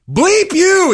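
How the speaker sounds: high-pitched mock outburst; retold yell. Acting teacher cursing at his student, retold yell. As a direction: imitate the teacher yelling, pitch jumping up. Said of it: "he yells".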